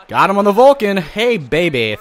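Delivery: loud, high, thrilled yell at the strikeout; excited shout. Real, not acted. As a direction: belt it out like you just struck him out. Strikeout celebration in game.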